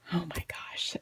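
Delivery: whispered disgust. Real, not acted. Disgusted aside about poo.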